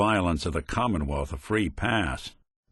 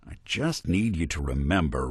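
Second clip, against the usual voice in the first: ordinary talk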